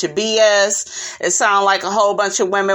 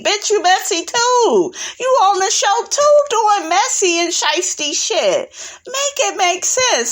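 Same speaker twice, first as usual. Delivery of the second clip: indignant call-out; 10 semitones higher than usual. Real, not acted. Calling out Monique's hypocrisy.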